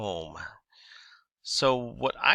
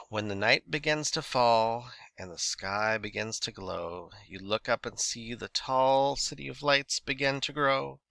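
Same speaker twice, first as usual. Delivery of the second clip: slow flowing reading. Shown as a demonstration. Teacher reading a night poem to show slow rhythm, slow and flowing. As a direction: let the lines flow slowly like night falling. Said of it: "You can also have a slow rhythm that kind of flows".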